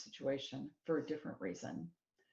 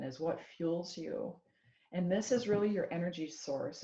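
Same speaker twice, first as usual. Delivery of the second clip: ordinary delivery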